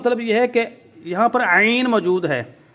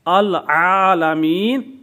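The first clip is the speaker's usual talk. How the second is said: recited slowly; 4 dB louder, much slower than usual. Shown as a demonstration. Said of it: "اصل میں تھا".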